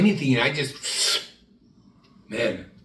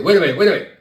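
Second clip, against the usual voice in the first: bouncer; 5 semitones higher, 8 dB louder, much faster than usual. Retold or imitated. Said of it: "He said".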